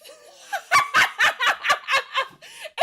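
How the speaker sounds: big high shrieking laugh; loud laugh. Real, not acted. Remembering the punch, howling with laughter. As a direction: burst out cackling loud.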